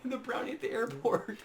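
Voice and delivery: laughing through the words; high, giggly retelling. Real, not acted. Laughing at the story.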